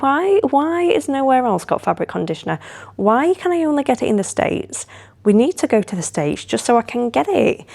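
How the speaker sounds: high, mock-whiny rant; whiny complaint. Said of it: "and I went".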